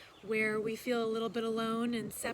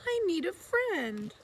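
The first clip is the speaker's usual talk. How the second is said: higher, far-off echoing voice; distant echo voice; 7 semitones higher than usual. A narrator's reading. The echo answering Little Beaver from across the pond, far-off and lonely. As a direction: call it back like an echo across water.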